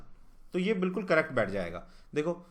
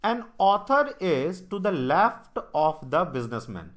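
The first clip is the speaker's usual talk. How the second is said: reading the English line out word by word, slowly; slow question read; 2 semitones higher, 7 dB louder, much slower than usual. Shown as a demonstration. Teacher slowly reads the puzzle statement to students, deliberate.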